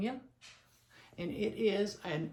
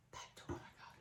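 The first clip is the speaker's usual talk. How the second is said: whispered aside; 17 dB quieter, much faster than usual. Real, not acted. Muttering to herself mid-haul.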